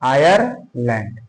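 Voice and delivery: Ireland drawn out slowly; teacher repeating a country name; slow dictation. Shown as a demonstration. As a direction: stretch Ireland for the class. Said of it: "कौन से?".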